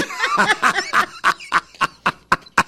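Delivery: long high-pitched laugh. Real, not acted. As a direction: let out a high cackle. Laughing at own joke.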